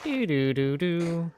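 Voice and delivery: slow hummed shutdown jingle; powering down. Retold or imitated. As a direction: hum the Windows shutdown sound slowly. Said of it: "Windows two thousand shut down".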